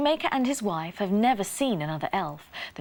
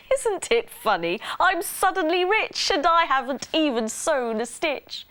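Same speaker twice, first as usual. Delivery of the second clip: higher, lifted voice of wonder; amazed shoemaker; 7 semitones higher, 4 dB louder than usual. A narrator's reading. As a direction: marvel at sudden good luck. Shoemaker marvels at sudden riches.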